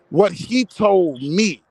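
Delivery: ordinary talk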